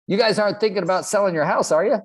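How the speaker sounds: oozing a totally inauthentic grin; fake salesman smile. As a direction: slick door-knocker leaning in with a fake smile. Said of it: "fakest smile you ever see".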